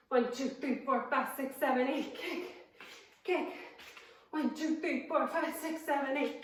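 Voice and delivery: quick counting with kicks; fast count. Shown as a demonstration. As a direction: rattle off the count briskly. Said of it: "you can also keep it slower".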